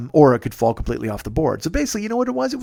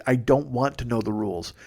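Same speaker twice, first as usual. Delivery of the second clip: usual speaking voice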